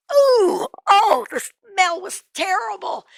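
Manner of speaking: high, loud groan of revulsion; disgusted recoil. A narrator's reading. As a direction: reel back from a stench with a drawn-out ooh. Recoils at a terrible smell.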